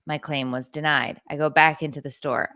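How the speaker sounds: ordinary delivery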